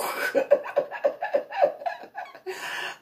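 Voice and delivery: high unvoiced laughter; real silent laughing; breathless laugh. Real, not acted. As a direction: wheeze with laughter.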